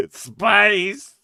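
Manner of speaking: high, hammed-up theatrical villain delivery; melodramatic, barely holding back laughter; Tim Curry. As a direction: chew the scenery on the word. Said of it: "it's like doing the Tim Curry".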